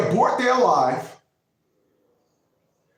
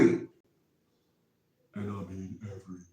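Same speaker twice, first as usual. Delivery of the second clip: very deep, much quieter rumble; 12 semitones lower, 12 dB quieter than usual. Shown as a demonstration. Host showing off his deep voice mid-rant. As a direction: drop way down into a bass voice. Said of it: "that was my deep voice".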